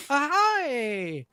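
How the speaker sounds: put-on high-pitched Italian-accented exclamation, falling away; brief put-on Italian lilt; mock Italian accent. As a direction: slip into a cartoon Italian accent. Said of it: "whether he wants to be Italian".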